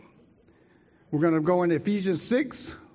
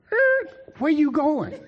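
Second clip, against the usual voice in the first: high, slow calling mother voice; 12 semitones higher, 5 dB louder, slower than usual. Retold or imitated. Mother stops her son at the door, high call. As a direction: stop him at the door, high. Mother calls after boy.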